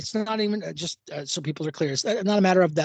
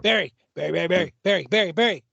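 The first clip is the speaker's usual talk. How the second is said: commercial camel; faster than usual. Retold or imitated. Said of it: "I love that fucking camel".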